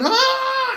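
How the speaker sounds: very high sudden mock shriek; retold passenger shriek; imitated outburst. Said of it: "then she does it again".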